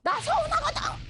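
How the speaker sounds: high-pitched, mock-upset wife; hurt protest. Wife telling husband she feels hurt, protesting.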